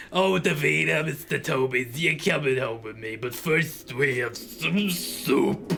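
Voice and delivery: hammed-up doting pet owner; gushing Francine. Francine taking her pets home for soup, theatrically gushing.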